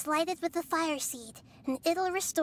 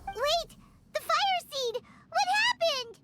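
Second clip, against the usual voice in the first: high-pitched startled outcry; alarmed; 9 semitones higher than usual. Acted. Paimon seeing the Fire Seed break, alarmed. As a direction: cry out in shock, pitch jumping up.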